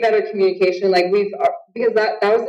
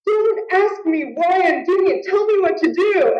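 pleading, raised pitch in the heat of it; retold argument; 10 semitones higher than usual. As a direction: snap back at the coach. Arguing with coach mid-fight.

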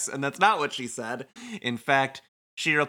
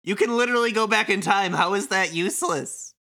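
high, rushed disbelief; incredulous; 7 semitones higher than usual. Acted. Shoka scolds friend calling himself useless, incredulous. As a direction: protest in raised pitch, incredulous.

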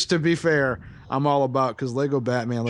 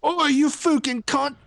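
mock Cockney; 14 semitones higher than usual. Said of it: "Or it's a Cockney".

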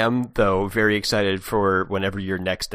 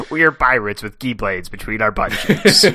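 pirate voice; 3 dB louder than usual. Pretend. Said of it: "it's a pirate voice now".

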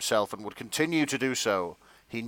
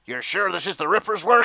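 police chief voice; 8 semitones higher, 3 dB louder than usual. Acted. [name] voices police chief Jack.

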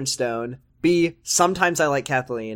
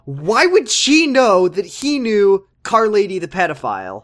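high, loud, drawn-out disbelief; incredulous rant; 7 semitones higher, 6 dB louder, slower than usual. Real, not acted. Host rants to co-host about a senseless plot, incredulous. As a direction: hammer out the question slowly, baffled.

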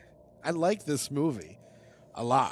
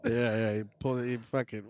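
usual speaking voice

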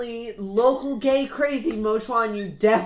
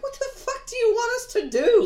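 much higher, laughing, incredulous mock whine; exasperated kids; 13 semitones higher than usual. Lawn kids answering Madame Mo, high, laughing and exasperated. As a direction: play the kids as baffled and fed up. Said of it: "the lawn kids are just sitting there like".

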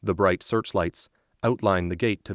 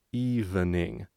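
put-on hesitant rising boy's voice; boy repeating carefully; slower than usual. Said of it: "repeats Gio".

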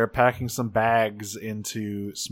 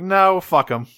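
raised, louder, emphatic dismissal; spiteful refusal; 6 semitones higher, 6 dB louder than usual. Retold or imitated. Alexis refuses to warn campers of rain, spiteful. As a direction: refuse with relish, imitating spite.

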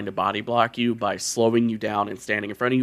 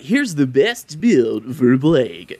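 streamer voice; 3 semitones higher, 5 dB louder, much slower than usual. Retold or imitated. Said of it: "You gotta be like".

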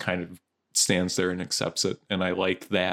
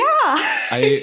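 high laugh breaking through words; 7 dB louder than usual. Real, not acted. Laughs at own lack of answer.

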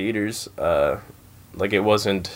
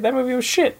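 high mocking voice; 3 dB louder than usual. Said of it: "It's like".

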